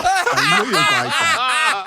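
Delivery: high, slow laughing repeat; laughing hard. Real, not acted. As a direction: gloat happily while laughing. Pleased after the joke lands.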